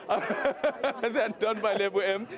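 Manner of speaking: slow, laughing through the words. Real, not acted. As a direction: laugh it out, still catching breath. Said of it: "Jeez, I'm out of breath".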